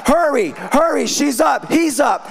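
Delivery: high-pitched, lightly voiced panicked devils; mock screaming devils. Devils alerting each other that someone is up, mock screaming. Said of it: "you're gonna hear devils screaming".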